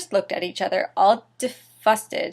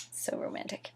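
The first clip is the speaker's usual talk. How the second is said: whisper; 19 dB quieter than usual. Real, not acted. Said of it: "mocking whispered aside".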